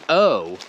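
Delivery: O as "aw"; O as an open pure vowel; non-English O. Shown as a demonstration. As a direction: say O like "aw", no glide. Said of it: "is never pronounced O".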